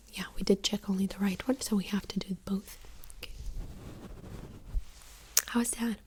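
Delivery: ordinary delivery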